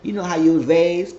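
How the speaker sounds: high laughing oh, then a loud scoffing question; squealed mocking retort; mocking disbelief. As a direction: laugh at him and throw his words back. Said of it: "She said".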